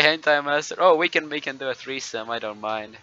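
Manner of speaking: ordinary talk, nothing marked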